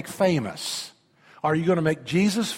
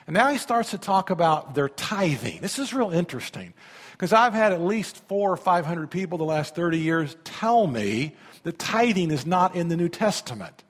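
ordinary talk, nothing marked

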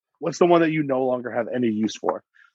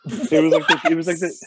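laughing; 4 semitones higher than usual. Real, not acted. Laughing while talking.

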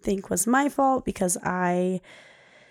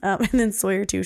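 ordinary delivery

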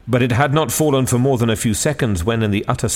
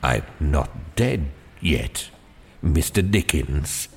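lower, slow frail reply; a faint smile in a tired voice; lightly voiced feeble old man; 5 semitones lower, 4 dB quieter, slower than usual. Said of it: "with a feeble smile".

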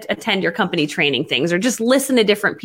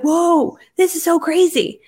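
excited whoa; 7 semitones higher, slower than usual. Retold or imitated. Thrilled at first sales.

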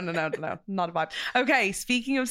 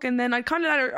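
ordinary delivery

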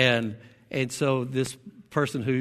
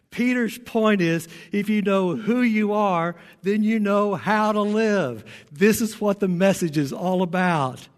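higher-pitched, driving sermon delivery; raised, insistent preacher's voice; emphatic preaching; 6 semitones higher, 3 dB louder than usual. Real, not acted. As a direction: drive the main point home to the congregation. Preacher drives home main point.

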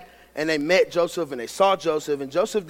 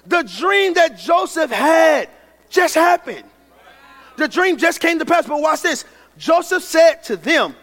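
rising preacher's voice, higher and louder; preaching build; 8 semitones higher, 5 dB louder than usual. Acted. Preacher tells congregation the dream came true, rising fervor. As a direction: lift the room as the dream comes true.

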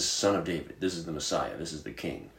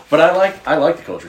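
laughing; 8 semitones higher, 10 dB louder, faster than usual. Real, not acted. Laughing off redneck teasing.

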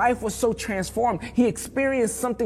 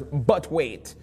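low-pitched mock West Indian accent; 5 semitones lower, 4 dB quieter than usual. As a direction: quote the island saying low in a West Indian lilt. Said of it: "they've got this saying when".

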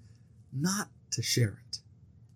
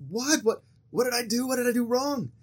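much higher, alarmed and confused; bewildered what; 9 semitones higher than usual. Retold or imitated. New missionary to his stern companion, higher-pitched bewildered alarm. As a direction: blurt it out like a caught rookie. Greenie baffled by companion's rebuke.

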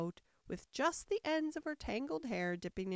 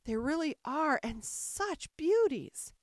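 high, lingering rapture; enchanted little-girl exclamation; sudden delight; 6 semitones higher, 4 dB louder, slower than usual. A narrator's reading. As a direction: gush slowly over something lovely. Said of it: "cried in a sudden transport of delight".

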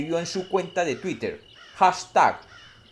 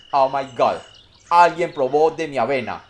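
papa bear; 8 dB louder than usual. Acted. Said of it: "el papá oso dijo".

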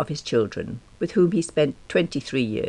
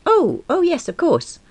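flustered; 3 dB louder than usual. A narrator's reading. Flustered reply to boss.

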